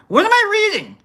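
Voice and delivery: high-pitched, comic bewilderment; exasperated squeaky question; baffled squeal. Real, not acted. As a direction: shoot up into falsetto disbelief. Same question repeated in baffled squeal.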